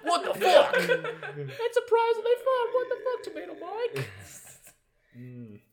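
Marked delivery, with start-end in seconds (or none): 0.0-4.1 high, squealing laughing disbelief; laughing outrage. Real, not acted.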